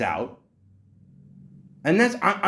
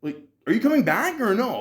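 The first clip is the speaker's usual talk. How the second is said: mock calling after someone, pitched up; yelling; 7 semitones higher than usual. Patient calls after departing doctor, mildly yelling. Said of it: "I'm yelling".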